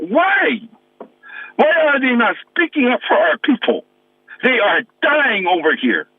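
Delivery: real frustration breaking through, pitch up; mad. Real, not acted. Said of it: "I'm mad".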